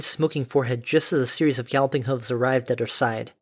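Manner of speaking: plain reading voice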